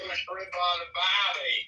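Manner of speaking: slow, hammed-up Australian accent; mock Australian accent. Mocking an Aussie friend in a slow Australian accent. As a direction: put on an Aussie drawl for the famous line. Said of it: "I wish I could speak with a cool accent like that".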